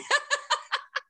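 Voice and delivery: high giggle burst; laugh. Real, not acted. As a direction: giggle high at your own joke. Laughs at own impression.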